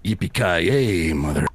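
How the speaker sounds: slow, deep tough guy catchphrase; action hero voice. Acted. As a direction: drawl it like an eighties hero. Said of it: "deep voice action hero voice".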